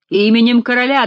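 loud, ringing command; powerful call. A narrator's reading. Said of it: "донёсся мощный голос Криона". (мощный голос)

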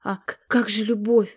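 timid, faltering plea; plaintive stammer. Acted. Stezhka plaintively asks her sisters about love. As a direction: falter the question like a hurt child. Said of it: "жалобно пролепетала Стежка".

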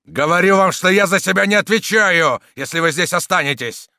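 high, loud furious warning; angry shout. A narrator's reading. Soldier stamping at the villain, angry shouting. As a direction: shout the threat as if stamping a foot. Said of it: "топнув ногой, закричал Дагобер".

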